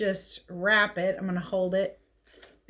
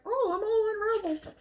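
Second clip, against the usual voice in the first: high, fast little mummy wail; a tiny mummy fretting as he comes undone; dismayed mummy; 13 semitones higher than usual. Retold or imitated. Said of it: "he's like".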